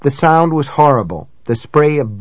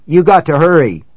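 panicky; 5 semitones higher, 4 dB louder than usual. A narrator's reading. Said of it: "He sounded panicky".

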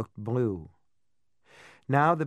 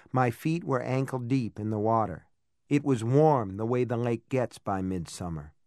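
ordinary delivery

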